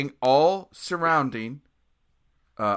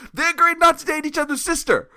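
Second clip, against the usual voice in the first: high, loud comic exasperation; frantic bafflement; 10 dB louder than usual. Retold or imitated. Musician explains singer's Nazi friendship to guitarist, frantically baffled. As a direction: explain it in a baffled near-shriek.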